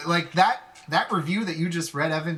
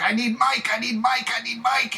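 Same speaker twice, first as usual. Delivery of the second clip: higher, insistent repeated plea; pleading for Mike; 7 semitones higher than usual. Retold or imitated. Fan outside tour bus begging manager, high pleading voice. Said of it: "I'm like".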